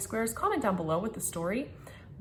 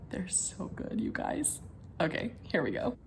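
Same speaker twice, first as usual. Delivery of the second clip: whispering; 10 dB quieter than usual. Real, not acted. Hushed excitement while cooking.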